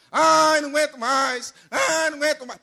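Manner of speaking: high-pitched, repeated whine; mock complaining. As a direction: wail it like someone who complains about everything. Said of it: "tem gente que reclama".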